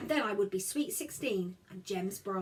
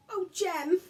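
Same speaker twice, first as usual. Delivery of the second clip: mild sobbing; 9 semitones higher, 4 dB louder than usual. A narrator's reading. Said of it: "I said, sobbing".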